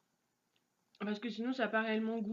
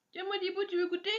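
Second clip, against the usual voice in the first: pet talk; 9 semitones higher, 6 dB louder than usual. Real, not acted. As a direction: coo at the little one. Offering a snack to a pet.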